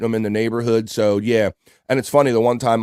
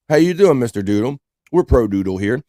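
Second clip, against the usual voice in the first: ordinary delivery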